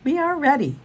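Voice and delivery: high, loud, eager character line; eager announcement. A narrator's reading. Pablo tells his friends they are ready, eager. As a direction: announce it brightly, pitched well up.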